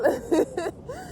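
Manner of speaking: short laugh. Real, not acted. Laughing while recalling dancing women.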